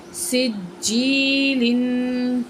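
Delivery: every sound stretched very slowly; slow blended reading. Shown as a demonstration. Teacher joining syllables into a word for the pupil, very slow. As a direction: draw the joined word out carefully. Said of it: "تو پڑھتے ہیں".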